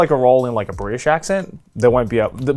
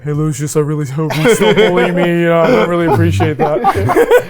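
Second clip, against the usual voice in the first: teasing impression of a friend's everyday voice; mock nerd character in a friend's unchanged voice; Charlie; 2 semitones higher than usual. Player meets the nerd character in a game, teasing imitation. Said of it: "It's just like Charlie's voice".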